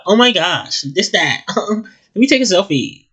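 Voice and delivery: high, bubbly Valley girl mock; Valley girl accent. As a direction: ham up a Valley girl gushing. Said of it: "That's a terrible accent".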